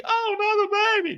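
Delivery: put-on falsetto whine over another baby; high mock whimper. Mireille hearing about another baby, high falsetto whine. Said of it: "And he".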